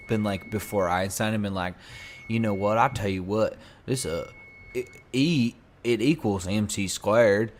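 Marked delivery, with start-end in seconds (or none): 2.3-7.5 put-on plain folksy Southern accent, a bit loud; classic Southern accent. Explaining physics before Einstein in a classic Southern accent. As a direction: put on an everyday Southern twang. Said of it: "a classic Southern accent".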